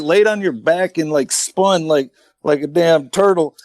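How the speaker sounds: normal talking voice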